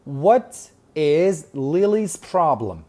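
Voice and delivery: What is Lily's problem read very slowly. Shown as a demonstration. Teacher reading the question slowly to students. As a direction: lay it out word by word. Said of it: "reads question slowly for class".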